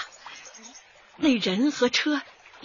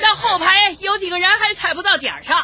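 higher, louder group instructions; calling instructions; 8 semitones higher, 6 dB louder than usual. Acted. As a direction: lift the voice so the back rows hear. Instructing dancers across square.

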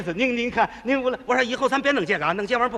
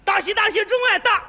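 shouting congratulations; 9 semitones higher than usual. Retold or imitated. Bursting in on the bride's home, shouting congratulations. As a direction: bellow the wedding greeting high. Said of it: "进门我就喊".

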